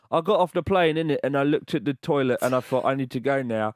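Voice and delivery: real working-class voice. Shown as a demonstration. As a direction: drop the posh act for a working-class accent. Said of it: "If I did it in my real voice".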